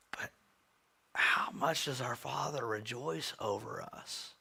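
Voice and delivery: slow hushed whisper; preacher whispering a tender question. Real, not acted. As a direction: ask it softly, almost breathing it. Tender sermon climax.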